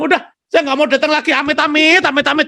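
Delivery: high, loud disgusted no way; exasperated refusal. Retold or imitated. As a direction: spit out amit-amit in disgust. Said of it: "Jim Murphy bilang".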